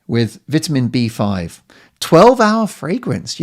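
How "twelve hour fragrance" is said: slow, much higher, reading the label in mock wonder; slow mock-impressed read of the label; mock awe. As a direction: savour each word of the label with exaggerated awe.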